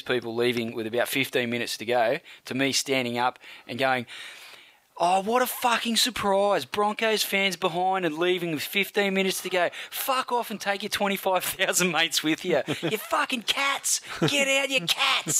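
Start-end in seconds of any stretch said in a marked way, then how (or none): 5.0-15.4 retold heckling. Standing up at the Broncos fans leaving early, heckling. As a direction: heckle them from the stands, climbing higher.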